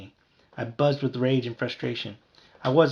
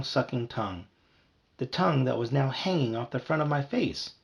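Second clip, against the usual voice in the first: ordinary delivery, nothing marked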